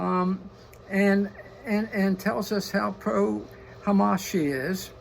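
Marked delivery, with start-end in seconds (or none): none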